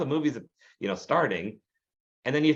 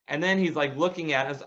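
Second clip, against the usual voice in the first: usual speaking voice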